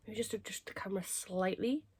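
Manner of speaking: a quick whisper to yourself; hushed aside to self. Real, not acted. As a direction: murmur it under your breath while fiddling with the camera. Adjusting the camera, aside.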